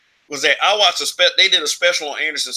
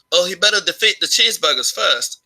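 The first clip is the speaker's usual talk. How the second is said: mock a British boxer's corny joke in a bad British accent; 5 semitones higher than usual. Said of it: "that was a really bad British accent".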